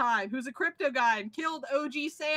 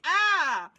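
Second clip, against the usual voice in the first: startled cry; 5 semitones higher, 7 dB louder than usual. Retold or imitated. Party startled awake by Jax Beast.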